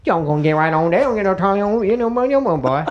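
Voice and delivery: garbled local babble, climbing in pitch; mock riverside gibberish; unintelligible local. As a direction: run words together like a cartoon local. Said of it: "I don't understand what you're saying".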